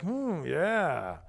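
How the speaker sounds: low, slow, dreamy admiring murmur; lovestruck guy. Retold or imitated. Imagined Boaz eyeing Ruth, lightly voiced smitten murmur. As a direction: savour the words like a smitten dude. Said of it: "the dude's like".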